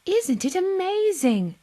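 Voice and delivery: high lightly voiced old lady; delighted old Beacon Hill lady. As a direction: marvel at the ducks.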